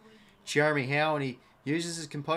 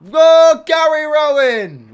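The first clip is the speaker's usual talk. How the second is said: a real shout, much higher and louder, drawn out; shouting a name; 11 semitones higher, 18 dB louder, much slower than usual. Real, not acted. Fan calls out the player's name after a huge mark, shouting. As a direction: belt out the player's name in delight.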